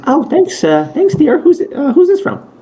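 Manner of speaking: high-pitched falsetto nurse voice; Nurse Joy. Acted. Game master as nurse receiving a parcel, high put-on voice. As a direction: pitch it way up for a polite nurse character. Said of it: "uh, she goes".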